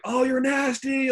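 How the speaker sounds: bullpen yell. Retold or imitated. Teammate cheering a strikeout from the bullpen, yelling. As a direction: yell it long and high, hyping up a teammate. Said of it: "like, yelling from the bullpen".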